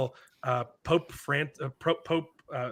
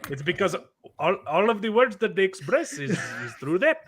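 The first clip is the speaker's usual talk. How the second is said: tongue tip to the roof of the mouth, Indian accent; 6 semitones higher than usual. Shown as a demonstration. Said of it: "you just gave me the secret".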